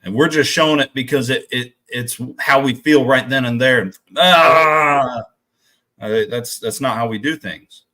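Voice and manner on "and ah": a loud, high mock whine of a child; a hammed-up little boy's frustrated cry; mock whiny cry. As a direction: imitate a kid's meltdown wail. Mimicking son's meltdown.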